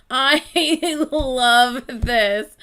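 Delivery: slow, loud, laughing through it. Real, not acted. Reacting to the lyrics with a laugh. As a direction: gush with a laugh.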